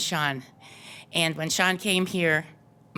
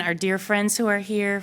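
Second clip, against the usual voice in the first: usual speaking voice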